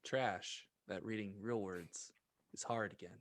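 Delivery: ordinary talk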